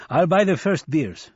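put-on lively old man. Nancy offering drinks.

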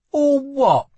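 high, loud challenge; challenging. A narrator's reading. Lila to guard bound to the door, challenging. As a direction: throw it back at him. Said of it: "challenged Lila".

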